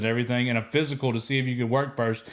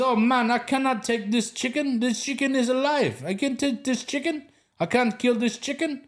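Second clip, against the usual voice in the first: put-on higher, alarmed Ghanaian accent; panicked Ghanaian accent; 10 semitones higher than usual. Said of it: "it mixes in with his accent".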